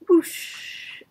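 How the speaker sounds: loud breathy whoosh; whoosh effect. Acted. Narrator making the splash sound, loud. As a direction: blow out the gush of water.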